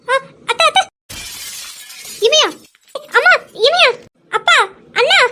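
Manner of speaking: alarmed calling out. Acted. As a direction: call out to the family in a panic. (பதறி உரக்க கூப்பிடுதல்)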